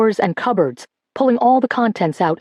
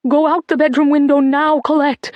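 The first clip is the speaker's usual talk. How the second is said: panicked shout; 6 semitones higher than usual. A narrator's reading. Mother orders daughter to flee, panicked shout. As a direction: shout her away in a rush of panic. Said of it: "Mum shouted back, her voice high with panic".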